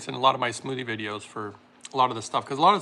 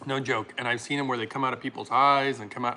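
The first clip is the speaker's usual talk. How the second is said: usual speaking voice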